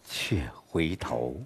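却回头 dropped low, soft and slow; hushed and drawn out, turning away; turning back. Shown as a demonstration. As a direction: let 却回头 sink softly as you look back. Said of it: "变化了".

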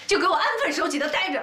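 high-pitched scolding command; stern order. Acted. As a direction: lay down the law to them. 婆婆训斥儿子儿媳. (高声严厉训斥)

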